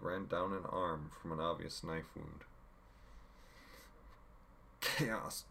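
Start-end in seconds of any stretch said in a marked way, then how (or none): 4.8-5.5 weak voice, then a cough. A narrator's reading. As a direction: barely get it out, coughing. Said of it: "she barely managed".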